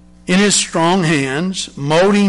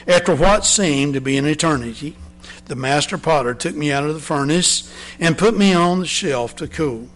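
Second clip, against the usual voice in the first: ordinary delivery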